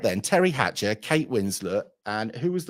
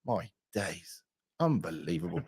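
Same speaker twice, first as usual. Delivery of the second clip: hushed, softer, in awe; awed murmur; 5 semitones lower, 8 dB quieter than usual. Retold or imitated. Man recalls seeing Selma Hayek, hushed awe. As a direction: breathe it out, stunned. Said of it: "I went".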